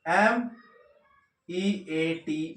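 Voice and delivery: slow spelling. Shown as a demonstration. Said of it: "spelling out meat".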